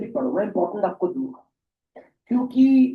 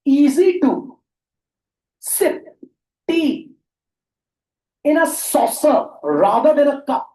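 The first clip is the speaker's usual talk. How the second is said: loud, slow question read to class; reading question aloud; 7 semitones higher, 7 dB louder, much slower than usual. Shown as a demonstration. Teacher reads question aloud. (जोर से धीरे-धीरे सवाल पढ़ना)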